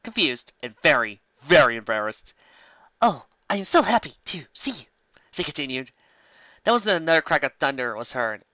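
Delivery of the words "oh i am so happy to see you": happy mother. A narrator's reading. Mother gushing.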